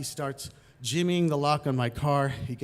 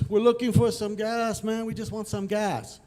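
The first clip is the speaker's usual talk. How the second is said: imitated punk, higher with stretched words; calling out; 6 semitones higher than usual. Punks keep walking towards the driver, calling out. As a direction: call over insistently, pitched up.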